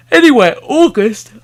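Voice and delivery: talking through a yawn. Real, not acted. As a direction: say it mid-yawn. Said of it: "I don't know why I'm yawning".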